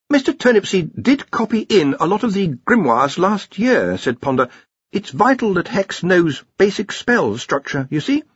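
put-on a young researcher stammering out an answer; nervous young wizard. Ponder justifying the machine.

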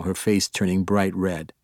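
plain reading voice

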